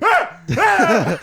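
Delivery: loud high mock scream of disgust; mock disgusted yell. Friend imitating John's loud high disgusted scream. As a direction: shriek like you just got sneezed on.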